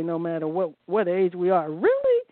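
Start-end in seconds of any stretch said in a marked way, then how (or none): 1.7-2.2 high, incredulous "Really?" as if told a silly age; playful mock astonishment; mock disbelief. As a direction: pretend shock at her own age, pitched way up. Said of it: "we should all be able to say".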